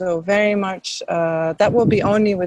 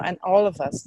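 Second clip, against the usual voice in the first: ordinary delivery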